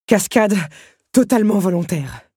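loud, playful mock-proud claim; cheeky cover-up after a fall; amused assertion. A narrator's reading. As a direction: declare it boldly with a slight smile. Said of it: "affirma-t-elle avec un léger sourire".

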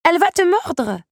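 put-on high, loud warning cry from a child; imploring child. A narrator's reading. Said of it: "avait fini par implorer l'enfant". (supplication alarmée d'une voix d'enfant)

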